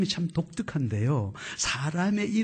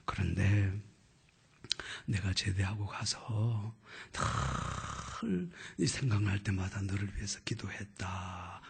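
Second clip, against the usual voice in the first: put-on tender old teacher; 7 semitones lower, 5 dB quieter than usual. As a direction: speak gently as an old teacher. (낮고 부드럽게 다정한 스승 목소리)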